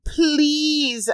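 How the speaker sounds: a high, pleading "please"; begging. A narrator's reading. Narrator begging her attacker to stop, pleading. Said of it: "I begged".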